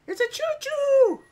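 very high squeal of delight; childlike excited squeal. Pretend. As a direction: squeal it like an excited little kid. Spots train, childlike squeal.

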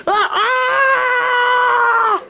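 long high scream of pain. Acted. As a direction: howl as the attack lands. Hit by dry bones.

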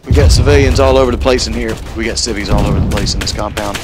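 loud urgent callout; shouting alert. Real, not acted. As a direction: shout the warning to the squad. Alerting team to civilians.

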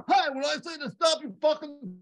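high, loud mock cop yell; imitating angry cop. Retelling Southie cops banging on your window, mock angry yell. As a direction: yell like an irate traffic cop, hammed-up.

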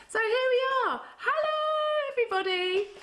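sing-song high hello; excited hello. Real, not acted. Greeting children after song.

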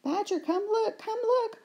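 very high, eager calling; excited call. Acted. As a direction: call out to come look. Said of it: "cried Red Squirrel".

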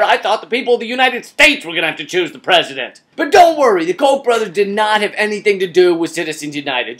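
ordinary talk

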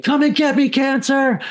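high and loud, a retold battle cry; defiant scream. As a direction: challenge cancer like a fighter beating his chest. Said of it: "screaming at the top of my lungs".

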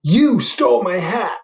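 high, loud, slow outraged shout; angry accusation. Acted. Bear confronting the rabbit, loud outraged shout. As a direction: accuse the thief.

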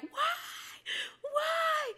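high-pitched wailed why, acted out; mock crying scream. Woman retells crying alone in her car, high imitated wail. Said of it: "screaming, crying, literally like".

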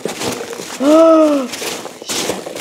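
sharp thrilled intake of breath; high excited gasp; delighted gasp. Real, not acted. Sees the packing peanuts.

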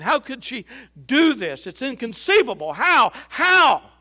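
crying out. A narrator's reading. Heartbroken Joseph crying out alone, building wail. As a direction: wail the question to heaven. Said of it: "cried out again".

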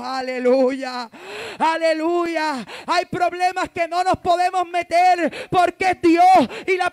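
high, loud, slow fiery sermon; loud impassioned preaching; full-force preaching. Acted. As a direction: preach it at the top of the voice.

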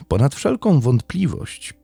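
ordinary delivery, nothing marked

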